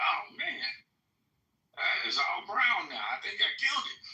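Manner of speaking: high-pitched, exclaimed mock dismay; acted dismay. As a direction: play out discovering a disaster. Acts out finding rose bush dying.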